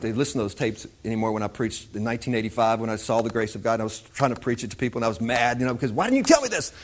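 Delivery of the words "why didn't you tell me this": high, exasperated cry of why; mock indignant complaint; frustrated outburst. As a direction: replay your younger self's frustration, pitched way up. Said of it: "because I was so frustrated".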